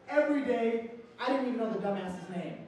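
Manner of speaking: ordinary talk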